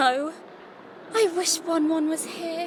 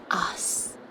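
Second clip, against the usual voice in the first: soft breathless whisper, much quieter; dreamy whisper; 9 semitones lower, 8 dB quieter than usual. Acted. Lavender whispering lovingly about Won-Won. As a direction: whisper it like a secret crush. Swooning over her boyfriend.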